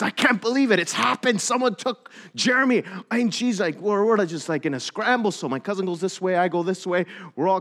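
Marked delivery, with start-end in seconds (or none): 0.0-2.8 imitating a panicked mother, higher pitch; mock-distraught, raised voice; retold freak-out. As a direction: re-enact a distraught parent without real tears. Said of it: "like, freaking out, crying".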